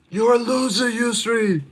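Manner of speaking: very high, thin-volume imitation of a yell; mock yell. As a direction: yell it from afar without real volume. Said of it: "someone just yelled that out".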